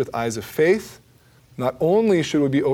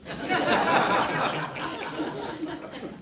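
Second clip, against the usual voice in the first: high laugh; 5 dB quieter than usual. Real, not acted. Laughs at own joke.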